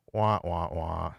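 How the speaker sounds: low, falling "wah wah wah"; deflating trombone sting after a punchline; comic sad-trombone noise; mock sad trombone.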